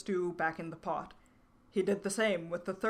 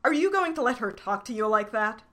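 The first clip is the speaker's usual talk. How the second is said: loud, raised demand; 4 semitones higher, 7 dB louder than usual. A narrator's reading. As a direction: press him hard for an answer. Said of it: "the yam person demanded".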